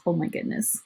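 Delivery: breathy hushed oh my goodness; hushed aside. Real, not acted. Teacher reading aloud, hushed reaction to the smell. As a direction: mutter it under your breath in disgust. Reacting to stinky dog picture.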